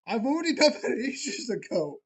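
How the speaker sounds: high, loud laughing disbelief. Real, not acted. Laughing at the game's request.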